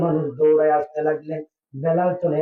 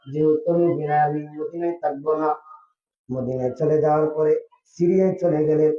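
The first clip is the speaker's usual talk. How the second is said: normal talking voice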